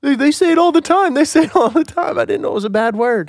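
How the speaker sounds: put-on very high pleading child voice; mortified kid. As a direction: imitate a mortified kid making excuses. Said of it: "He was so mortified".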